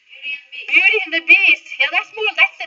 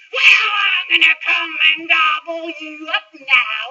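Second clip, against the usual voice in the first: roaring troll; 3 semitones higher than usual. Acted. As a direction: roar the threat slowly. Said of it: "roared the troll".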